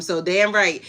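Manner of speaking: ordinary delivery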